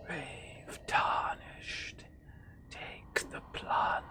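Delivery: slow, eerie whispered ghost. Shown as a demonstration. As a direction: breathe it out like a spirit. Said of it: "gotta read in the ghost voice".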